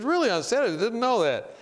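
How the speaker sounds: high-pitched, interested reply to draw someone out; retold surprised interest. Drawing out a passenger about her faith, surprised and interested.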